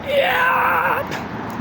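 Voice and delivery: high screamed "Yeah!"; mock metal scream. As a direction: shriek it like a metal vocalist. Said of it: "high screams".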